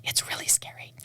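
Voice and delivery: whispered, almost secret; hushed whisper. Real, not acted. Singer admitting stage fright to interviewer, whispered. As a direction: drop to a whisper on the scary part. Confessing nerves about unprepared shows.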